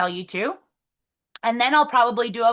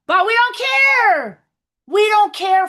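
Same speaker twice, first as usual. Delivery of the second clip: emphatic; 7 semitones higher, 7 dB louder, slower than usual. Real, not acted. Brushing off her messy hair.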